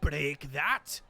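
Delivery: loud indignant dwarf; outraged bellow. Acted. Dwarf lord outraged at his ship's horn, bellowing. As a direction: bellow at the blockade breaking.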